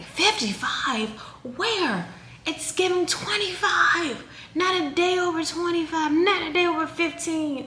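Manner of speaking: high-pitched mock disbelief, words drawn out; exaggerated hype voice imitating online flattery; gushing commenter. Everyone in the comment section reacting to her age, mock gushing.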